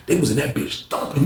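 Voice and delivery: ordinary delivery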